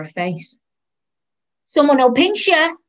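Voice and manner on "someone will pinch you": high, loud scolding shout; yelled down the aisle. Acted. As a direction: shout a warning at a wayward child. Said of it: "the woman shouted again".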